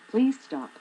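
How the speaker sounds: loud, high pleading please stop; exasperated plea, punching please; emphatic please stop. Real, not acted. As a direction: beg them to stop, hard.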